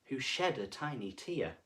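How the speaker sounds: plain reading voice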